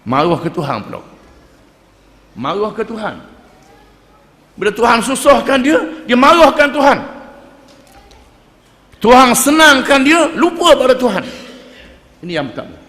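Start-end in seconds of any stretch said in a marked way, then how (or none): 4.5-11.2 louder and higher, hammering the point home; rising sermon rebuke; forceful preaching. Real, not acted.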